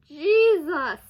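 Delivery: recoiling at a gruesome scene; loud shocked exclamation. Real, not acted. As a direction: blurt it out in shock. Reacting to Mickey chopped.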